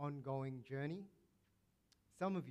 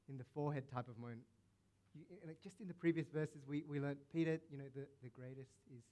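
ordinary talk

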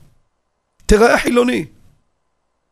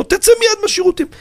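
retold shout. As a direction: shout him out of the toilets in alarm. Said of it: "צעקתי לו". (צעקה מבוהלת וגבוהה)

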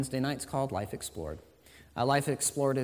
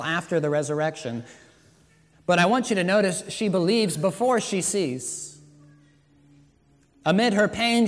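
loud, high sermon climax; preaching at full force; 6 semitones higher, 8 dB louder than usual. Acted. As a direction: rise to a fervent peak. Preaching to the congregation.

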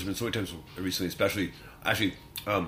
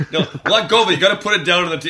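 laughing plea; 10 semitones higher, 13 dB louder, faster than usual. Retold or imitated. Joking at golf dinner.

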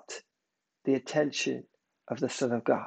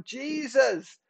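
higher, slow, drawn-out declarations of faith; bold crying out; 4 semitones higher, slower than usual. Acted. Believer boldly calling on Jesus for help, acted crying out. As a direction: cry it out boldly to heaven, stretching the words. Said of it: "you boldly cry out".

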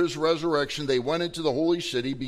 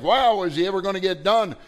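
louder, higher mock exasperation; impatient listener; 6 semitones higher, 6 dB louder than usual. Preacher mocking a listener tired of long sermons, exasperated. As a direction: huff it out like a bored churchgoer. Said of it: "it's like".

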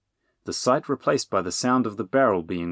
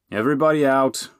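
raised, higher-pitched order; calling out; 6 semitones higher, 5 dB louder than usual. A narrator's reading. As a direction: call them out of the wagon. Friar calls out to hidden passengers.

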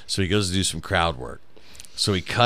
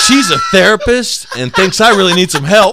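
laughing while telling; 10 semitones higher, 10 dB louder than usual. Real, not acted. Retelling while laughing hard.

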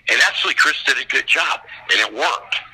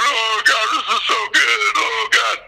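high-pitched imitation of a tearful audience; mock weeping; 7 semitones higher than usual. Moviegoers cry over the film, mockingly imitated as tearful. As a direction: lightly wail it, mocking the moved crowd. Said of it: "everybody's crying".

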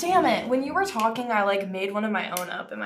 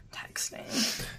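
whispered; 10 dB quieter than usual. Real, not acted. Speaking while texting.

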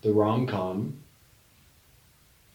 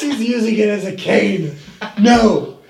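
high loud outraged yell; mock dismay; 10 semitones higher, 10 dB louder, slower than usual. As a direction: protest loudly. Acted skit, leg thief.

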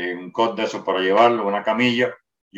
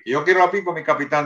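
higher, pleading insistence; insistent demand; 7 semitones higher than usual. Retold or imitated. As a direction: press the claim again, voice pitched up. Sergeant insisting on the pipe.

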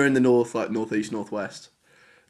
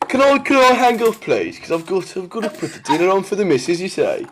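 put-on Brummie, often a little higher; Birmingham accent; 5 semitones higher than usual. Digbeth man excusing himself from the call, flustered Brummie. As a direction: fret in broad Brummie, keen to get away. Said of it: "just outside Birmingham".

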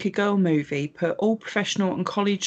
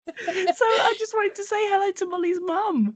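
cheery greeting; 12 semitones higher than usual. Real, not acted. Greeting a listener's mum.

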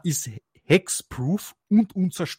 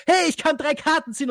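much higher, mock-delighted cheer; gleeful player; 13 semitones higher than usual. Host mimicking a player happy to draw cards, high mock glee. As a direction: play the hopeful player before the trap springs. Mocking a player's short-lived joy.

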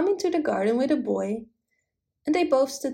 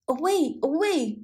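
hoarse barked warning, pitched up; barking old dog; 6 semitones higher than usual. Acted. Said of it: "barked the yard dog".

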